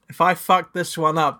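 usual speaking voice

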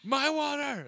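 put-on high, loud little-kid cry of "My water!"; toddler yelling. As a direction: yell it like a two-year-old. Repeating toddler's yell.

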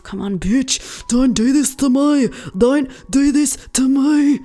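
loud, high pleading at the laptop; desperate plea. Real, not acted. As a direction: beg the laptop not to die.

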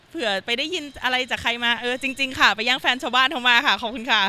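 loud, playful mock public announcement; mock confession. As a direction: own up to the rumour loudly, tongue in cheek.

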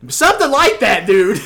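high, loud comic blurt; mock shouting. Dreamer confirming his theory to friend, high jokey shout. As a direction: yell the answer as a joke. Shouted answer, then laughs.

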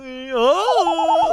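high squeaky cartoon yelp. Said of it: "just like that".

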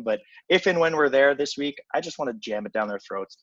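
ordinary talk

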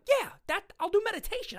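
insist, voice jumping up; emphatic refusal. Retold or imitated. Patient rejecting drugs to doctor, high insistence. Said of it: "And I'm".

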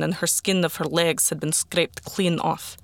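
ordinary delivery, nothing marked

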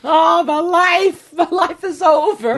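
high, drawn-out mock lament; slow, high-pitched theatrical moan; mock wailing. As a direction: wail it slowly, hammed-up despair. Acting out his third-day hangover misery.

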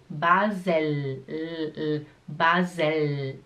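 BASEL with a long final L, tongue on the palate; low, lingering L at the end of "Basel". Shown as a demonstration. Teacher isolating the L for learners, held long. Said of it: "a língua é no céu da boca".

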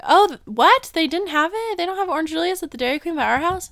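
high, fast disbelieving questions; surprised. Retold or imitated. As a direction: act shocked nobody had it. Dad shocked about the Orange Julius.

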